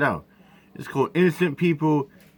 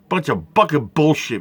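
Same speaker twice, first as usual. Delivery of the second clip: ordinary delivery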